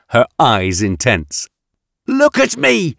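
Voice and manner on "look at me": very high, fierce command; barked order. A narrator's reading. Yatena confronts the woman in grey, barking at her. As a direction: bark it high and sharp. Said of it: "she barked".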